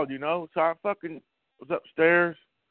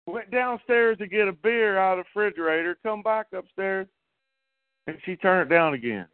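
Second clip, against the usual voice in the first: usual speaking voice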